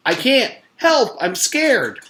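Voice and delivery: high, loud, slow scared plea; boy clinging to a brick, high and frightened. Acted. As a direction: cry out in fear. Paul frightened.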